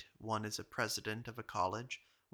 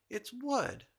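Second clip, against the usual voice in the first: much higher, thrilled cry of recognition; delighted recognition; 13 semitones higher than usual. Retold or imitated. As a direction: shout it as the penny drops. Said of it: "cried Moody".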